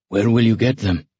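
gruff. A narrator's reading. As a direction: ask it with a touch of gruffness. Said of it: "he asked the brigadier gruffly".

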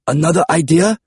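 high, uneasy question; mildly nervous query; a little apprehensive. A narrator's reading. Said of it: "clearly a little apprehensive".

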